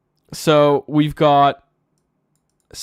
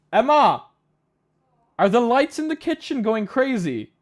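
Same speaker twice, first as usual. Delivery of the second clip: calling out; 10 semitones higher, 4 dB louder than usual. Real, not acted. Calling to someone in another room.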